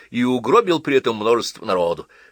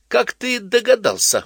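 high, loud, slow surprised; surprised tipsy girl; tipsy surprise; 14 semitones higher, 5 dB louder than usual. A narrator's reading. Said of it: "удивилась Ася".

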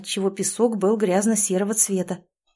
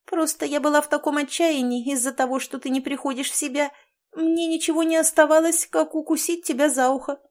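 fast, high fussy squeak; flustered; 7 semitones higher than usual. A narrator's reading. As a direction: brush it off in a hurry. Mouse explaining.

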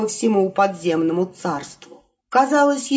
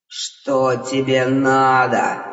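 low, slow, spiteful snap; 7 semitones lower, much slower than usual. Acted. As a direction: snap it out with malice. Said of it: "злобно бросил Хтон". (злобно, низко и медленно)

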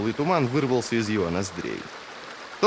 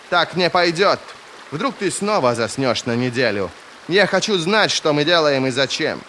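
indignant, raised-voice protest; insistent demand; 6 semitones higher, 4 dB louder than usual. Acted. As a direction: press hard for an answer, higher and louder. Heroine angrily demands answers. (требовательно, возмущённо, громко)

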